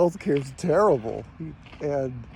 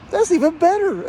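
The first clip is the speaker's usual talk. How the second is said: much higher, louder, gloating comeback; gleeful retort; 13 semitones higher, 5 dB louder than usual. Retold or imitated. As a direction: toss it back with delighted triumph. Retelling his own cheeky comeback.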